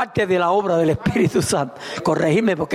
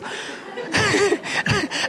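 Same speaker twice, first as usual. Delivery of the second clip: high laugh; 13 semitones higher, 4 dB quieter than usual. Real, not acted. Laughing at own sketch.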